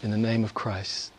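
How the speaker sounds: hushed prayer with long pauses; heartfelt prayer. Real, not acted. Preacher praying to God for his people, reverent and subdued.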